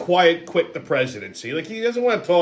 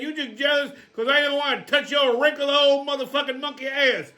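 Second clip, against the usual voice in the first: put-on angry old man; 7 semitones higher than usual. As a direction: bark insults at a rival. Angry character in sketch quarrel.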